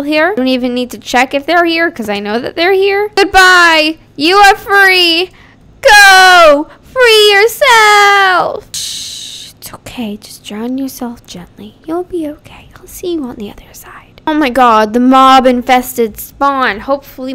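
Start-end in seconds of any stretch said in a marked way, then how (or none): 3.1-8.7 louder, higher, slow theatrical send-off; dramatic farewell shout. Pretend. Releasing her animals. 8.7-14.2 hushed soothing whisper. Pretend. Soothing animals as they drown.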